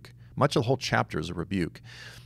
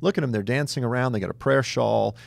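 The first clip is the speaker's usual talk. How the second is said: higher, excited mock envy; mock jealous onlookers; 6 semitones higher, 3 dB louder than usual. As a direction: gush like an envious bystander.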